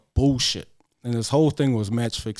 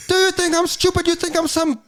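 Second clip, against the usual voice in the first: much higher, louder indignant mom voice; angry mom; 3 dB louder than usual. Retold or imitated. Mom scolding the waiter over a cracked plate, indignant. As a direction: play the outraged mother demanding a new plate.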